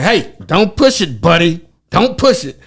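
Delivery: slow, loud, high warning; stern cop warning. Retold or imitated. Cop warning the suspect not to push it, slow loud threat. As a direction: warn him off, drawn out and loud.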